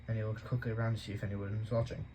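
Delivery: ordinary delivery, nothing marked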